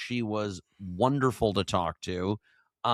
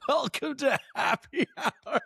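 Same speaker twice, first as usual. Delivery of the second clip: show opener; 3 semitones higher than usual. Acted. Host opens the show.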